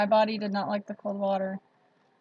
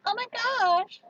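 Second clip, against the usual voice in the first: put-on high, airy valley girl gush; 12 semitones higher, slower than usual. Shown as a demonstration. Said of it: "I could talk like a valley girl".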